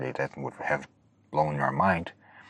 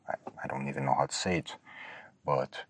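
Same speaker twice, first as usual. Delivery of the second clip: ordinary delivery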